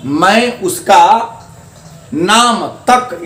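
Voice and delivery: slow, deliberate, with तक leaned on; stress on तक. Shown as a demonstration. Teacher slowing down to show students the stress on तक. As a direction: draw out मैं उसका then press नाम तक. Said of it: "यहां तक जोड़ दिए तो जोर ज्यादा हो गया".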